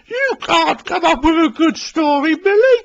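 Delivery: high, teasing voice with a chuckle; mock him, high and amused; high, mocking laugh in the voice; chuckling Daisy. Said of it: "she chuckled".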